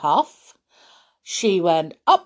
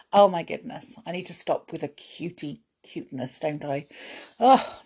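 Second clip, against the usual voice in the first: ordinary delivery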